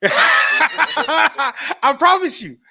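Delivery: high, loud, slow laughing shout. Real, not acted. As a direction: crack up while swearing it's true. Laughing hard.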